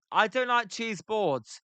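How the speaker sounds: normal talking voice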